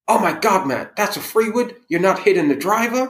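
disbelieving American, voice shooting up; amazed American golfer, high and incredulous; American accent. Retold or imitated. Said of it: "I'm gonna try and do my best American accent".

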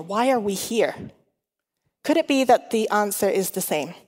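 ordinary talk, nothing marked

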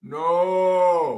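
high, loud, long-held mock cry; hammed-up movie howl of despair, stretched out; Darth Vader. Podcaster quoting a famous movie line, mock anguished cry. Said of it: "yeah, the No, Vader's famous".

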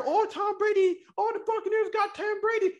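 high mock-excited fan voice; mocking gushing fan. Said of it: "He over here".

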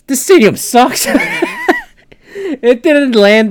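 high, loud words breaking into a laugh. Retold or imitated. Telling about the stadium, laughing at his own put-down. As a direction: laugh your way through the verdict. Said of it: "I, I just said".

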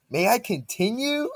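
mocking imitation. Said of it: "B P I was like".

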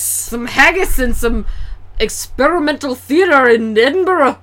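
hammed-up Scottish accent; mock Scottish accent. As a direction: lay on a Scottish brogue. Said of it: "How do you do a Scottish accent?".

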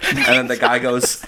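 ordinary talk

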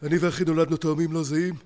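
lightly imitated deadpan one-liner; Elad Giladi. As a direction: deliver the joke flat and dry. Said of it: "אלעד גלעדי".

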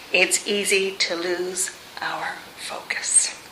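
breathy whispered drop. Acted. Preacher whispers to the congregation as Peter sinks. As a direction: let the words sink into a whisper. Hushed sermon point.

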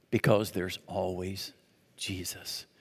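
slow, low and very soft; quiet, deliberate, weighty; hushed refrain. Real, not acted. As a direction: let the last word fall away, hushed.

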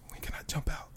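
hushed mocking whisper. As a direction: whisper it like a sneaky hider.

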